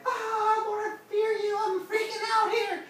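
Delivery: very high, loud freak-out; hammed-up shriek of terror; mock panic. Preacher acting the wrong idea of fearing God, mock panic. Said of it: "does not mean you're like".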